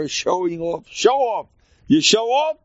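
high, emphatic mock jeer. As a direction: call it out with rising pitch.

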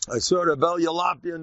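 usual speaking voice

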